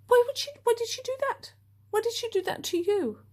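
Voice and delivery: imitating a friend who can't believe it, high and quick; baffled friend. Mocking her friend's disbelief at the free coffee, high and fast.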